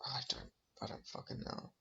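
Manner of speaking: whispered under the breath; whispering. Real, not acted. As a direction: drop to a frustrated whisper. Frustrated aside under breath.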